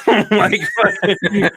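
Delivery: words breaking into laughter; giggling while talking; laughing. Real, not acted. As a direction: laugh through it. Laughing at the bad tweet.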